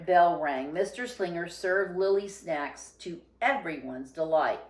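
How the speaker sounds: usual speaking voice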